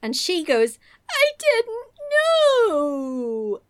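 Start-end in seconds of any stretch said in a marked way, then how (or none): 1.1-3.6 high, drawn-out wailing excuse; hammed-up sobbing. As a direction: whine it through fake tears. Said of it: "she just starts sobbing".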